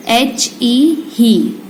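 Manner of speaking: एच ई ही spelled out very slowly, letter by letter; very slow spelling. Shown as a demonstration. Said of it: "ठीक है, ही". (एच ई ही बहुत धीरे-धीरे, अक्षर-अक्षर बोलकर)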